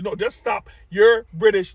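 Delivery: ordinary talk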